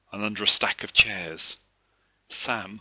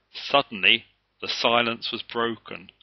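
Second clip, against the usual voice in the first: ordinary delivery, nothing marked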